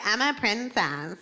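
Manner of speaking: slow, put-on princess voice; prissy princess. Woman describing a type of woman, slow prissy princess voice. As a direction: play the spoiled princess. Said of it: "women that's like".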